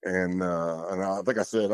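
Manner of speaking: ordinary talk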